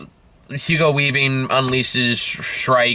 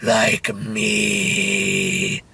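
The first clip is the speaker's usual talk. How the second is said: mock brooding robot; 3 semitones lower than usual. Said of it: "It looks at the camera and goes".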